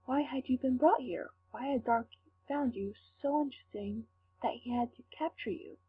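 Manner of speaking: usual speaking voice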